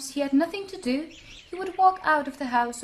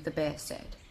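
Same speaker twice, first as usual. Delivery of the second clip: ordinary delivery, nothing marked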